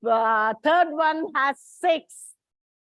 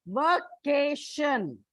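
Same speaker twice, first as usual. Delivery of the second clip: vacation said slowly with the stress on va, VA-cation; stress on first syllable; much slower than usual. Shown as a demonstration. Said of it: "the first one".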